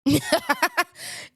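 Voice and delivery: high cackle; laugh. Real, not acted. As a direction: crack up at your own joke. Laughs at own remark.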